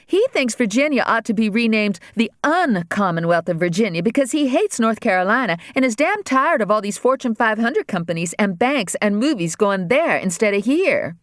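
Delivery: put-on a scornful girl, slowing on stupid. Daughter scorning the speech to her mother, disgusted. Regina voiced higher.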